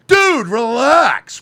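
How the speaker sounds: mock unhinged yell. Host mocks CoffeeZilla as an unhinged screamer, loud mock yell. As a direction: yell it like a man losing it.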